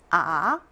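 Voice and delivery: 아 gliding upward from low; second tone. Shown as a demonstration. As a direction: Mandarin second tone on 아. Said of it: "이성입니다".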